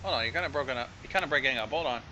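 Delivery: ordinary talk, nothing marked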